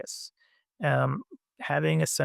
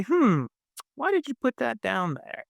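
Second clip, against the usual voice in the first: higher, musing "Hmm" then a gently puzzled question; curious; 7 semitones higher than usual. Pretend. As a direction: ask it with open, wondering interest. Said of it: "if he was curious, he would say".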